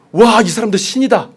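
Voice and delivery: loud, high burst of amazement; startled crowd exclaiming; shocked outcry. Acted. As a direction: cry it out as if seeing gods. Crowd suddenly cries out in shock.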